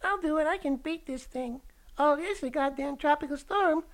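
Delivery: very high squeaky cartoon fire; a plucky tiny fire struggling; little fire voice. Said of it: "looking at the little fire going".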